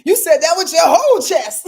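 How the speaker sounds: loud, high mock exclamation breaking into laughter; imitated amazed listener. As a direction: exclaim it big, as an impressed listener would. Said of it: "Kimberly's like".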